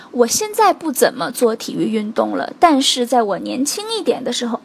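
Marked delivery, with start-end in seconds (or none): none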